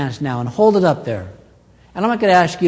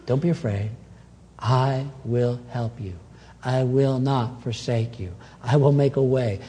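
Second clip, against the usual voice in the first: still small voice; 5 semitones lower, 7 dB quieter than usual. Acted. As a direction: speak gently, close to the heart. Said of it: "in that still small voice".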